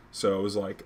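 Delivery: ordinary talk, nothing marked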